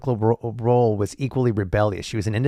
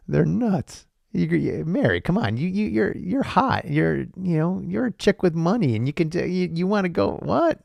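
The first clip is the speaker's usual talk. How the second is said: high excited pleading; 9 semitones higher than usual. Retold or imitated. Acted pleading with Mary.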